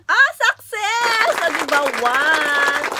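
high, loud and very slow victory shout; triumphant cry. Real, not acted. Vlogger celebrates her friend's yes, drawn-out triumphant shout. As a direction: stretch out the win like a cheer.

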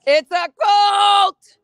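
loud, high, slow shout; slogan shout. Real, not acted. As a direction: call it out to the crowd.

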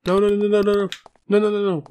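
high, loud rapid-fire squeal; imagined character panicking. Acted. Player voicing the endangered character, high loud mock panic. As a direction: yelp it out in a high put-on voice.

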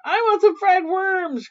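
high-pitched, demanding grouchy aunt; crying out. A narrator's reading. Grouchy aunt demands food from Little Witch, crying out. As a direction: cry out an order like a grouchy aunt. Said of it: "cried Aunt Grouchy".

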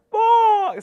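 very high, loud squawking bark; toucan call. Pretend. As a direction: squawk like a jungle bird.

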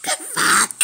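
real squealing laughter through the words, very high; high-pitched, breathless hysterics; helpless laughing. Real, not acted. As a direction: crack up so hard the words come out as loud squeaks. Cracking up at a silly game creature.

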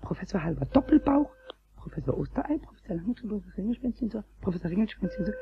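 soft whispered chant; quiet, focused incantation; murmuring. Acted. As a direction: murmur it like a magic spell. Concentrating hard on thinking.

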